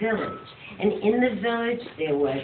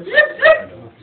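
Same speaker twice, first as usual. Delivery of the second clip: high, loud whooshing zoop; magic zoop sound; 9 dB louder than usual. Acted. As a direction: zap like a magic wand.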